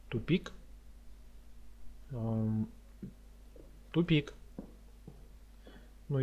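ordinary delivery

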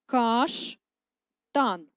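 каштан stretched, split the other way; second split dictation. Shown as a demonstration. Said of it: "Другий варіант переносу".

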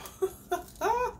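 a short high delighted giggle; high giddy laugh. Real, not acted. Giddy over package.

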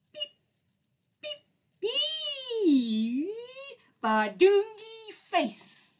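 loud, high, stretched gibberish; silly nonsense sounds. Acted. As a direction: go wild with goofy noises for the kids. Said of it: "make silly sounds like".

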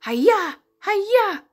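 high, punchy karate cries; playful ninja. Pretend. Teacher playing a ninja for children, high mock karate cries. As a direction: throw out "Hiya" like a ninja strike. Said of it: "I'm a ninja".

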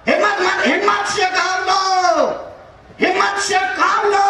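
high, stretched exhorting cry; rally them with a high, full cry. Real, not acted. Preacher urging the crowd to take courage, rallying.

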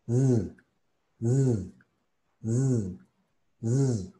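"z" as a sustained voiced buzz; vibrating Z. Shown as a demonstration. Teacher demonstrating English Z, "z" said as a vibrating buzz. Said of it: "la Z en inglés es vibrando".

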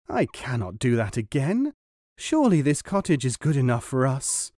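plain reading voice

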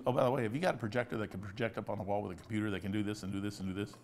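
quick, soft daughter's request, lightly voiced; imitating daughter. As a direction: rattle off the daughter's long ask quietly and fast. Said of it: "She goes".

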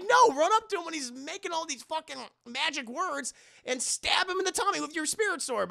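high, exasperated coaching; exasperated urging. Retold or imitated. Urging on the hero.

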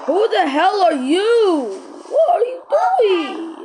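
slow high-pitched yell; high slow shout. Acted. Acted confrontation.

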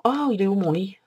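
suddenly lost the bed, call it out; loud alarmed exclamation; startled outcry. Real, not acted. Suddenly can't find his bed.

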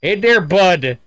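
imitated chummy Canadian accent; hammed-up Canadian accent; mock Canadian accent. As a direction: greet like a caricature Canadian. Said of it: "talked to me Canadian as fuck before".

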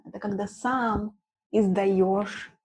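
normal talking voice

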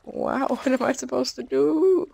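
high, slow exasperated wail. Real, not acted. Gamer stuck with no way out, high exasperated wail. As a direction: stretch it out in disbelief.

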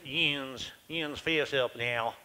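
slow, nasal hill-country accent; nasal hill dialect. Shown as a demonstration. Newcomer demonstrates how the hill people speak, in a nasal hill dialect. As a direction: say it through the nose like hill folk. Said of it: "dialect is a, a nasal".

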